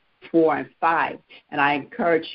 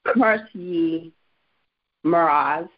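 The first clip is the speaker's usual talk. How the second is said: scripture reading; slower than usual. Real, not acted. Reads King James verse aloud.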